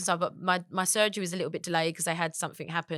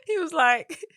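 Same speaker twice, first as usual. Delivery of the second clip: words breaking into laughter; laughing; 10 semitones higher, 5 dB louder, slower than usual. Real, not acted. Telling a friend about the nipple, laughing hard. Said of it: "laughing while telling".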